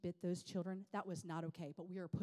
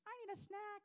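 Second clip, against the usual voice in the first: high-pitched child imitation; mock little-kid demand; whiny kid; 13 semitones higher than usual. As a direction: whine like a hungry kid. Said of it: "my kid's like".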